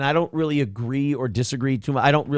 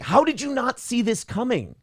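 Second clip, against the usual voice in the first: incredulous mock question; 10 semitones higher than usual. Mocking people who get called out.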